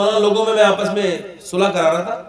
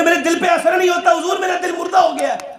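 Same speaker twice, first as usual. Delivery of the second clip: high, raised pleading voice; pleading disciple; 8 semitones higher than usual. Acted. Disciple to Baba Farid, pleading in a raised voice. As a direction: beg the master for help.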